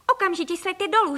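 high-pitched scolding mother; urgent order. A narrator's reading. Mother ordering flying children down, urgent.

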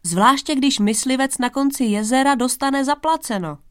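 vyšší, hlasitější škádlivý tón; popíchni knížete zvesela a výš; pobavený posměšek. A narrator's reading. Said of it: "zasmál se Kralevic". (pobaveně posměšný princ)

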